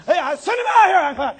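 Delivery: high, loud giant's challenge; loud mock-belligerent call; shouted demand. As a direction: holler for an opponent to come out. Goliath calling for a challenger.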